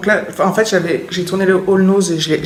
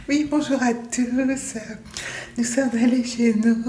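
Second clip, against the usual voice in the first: slow, high mocking voice; mocking imitation; 4 semitones higher, 3 dB quieter, slower than usual. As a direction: parody her vlog intro, drawn out. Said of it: "il venait de se foutre de moi en imitant".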